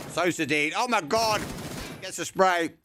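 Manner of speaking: sudden high excited call. Real, not acted. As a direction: burst out at the kill. Caster reacting to a spray kill.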